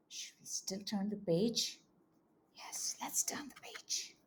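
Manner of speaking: soft whisper, as if telling a secret. Acted. Conspiratorial turn of the page.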